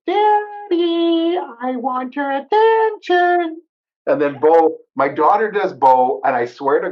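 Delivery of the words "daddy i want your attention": very high, slow, whiny little dog voice; Sammy the dog's voice. Pretend. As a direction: plead like a needy little pup, pitched way up and drawn out. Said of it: "Sammy's voice is like".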